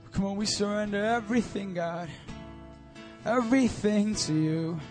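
normal talking voice

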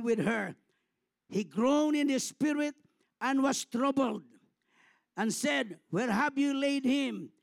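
loud, ringing sermon retelling of the tomb scene; full-force preaching. Acted. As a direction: preach it out to the whole church. Preaching the weeping at Lazarus' tomb.

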